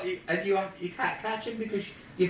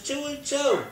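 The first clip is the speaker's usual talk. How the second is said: high-pitched called-out line; shouting across; 10 semitones higher, 5 dB louder than usual. Retold or imitated. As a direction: call it out to someone far away. Shouted joke exchange.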